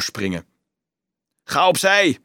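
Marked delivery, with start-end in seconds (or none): 1.4-2.2 loud, high snapped command; snarled order. A narrator's reading. Stranger ordering Dolf out of the way, snapping loudly. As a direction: snap at him to move. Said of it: "snauwde iemand hem toe".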